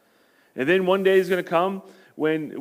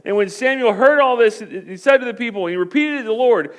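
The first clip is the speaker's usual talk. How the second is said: ordinary talk, nothing marked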